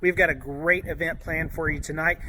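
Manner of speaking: usual speaking voice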